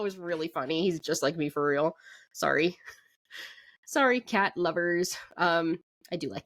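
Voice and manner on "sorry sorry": ordinary talk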